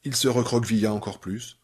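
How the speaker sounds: ordinary delivery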